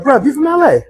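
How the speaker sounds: high English mock questioning; imitated English accent. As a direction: ask it in a put-on English accent. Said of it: "I love your accent".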